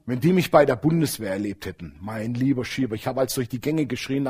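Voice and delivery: ordinary talk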